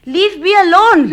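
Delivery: loud, drawn-out cry; shouted dismissal. Acted. Daughter shouting at mother and doctor to leave, slow loud cry. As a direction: send them away in a fury. Daughter drives them away.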